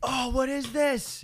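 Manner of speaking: high, slow amazed gasp of a question; delighted surprise. Real, not acted. Friend reacting to quote, delighted and high. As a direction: savor the quote with glee.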